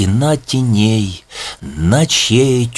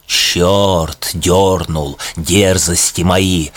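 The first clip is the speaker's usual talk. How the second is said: ordinary delivery, nothing marked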